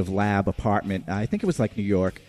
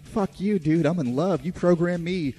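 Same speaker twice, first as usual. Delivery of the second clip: android defiant; 7 semitones higher than usual. Retold or imitated. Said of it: "The android's like".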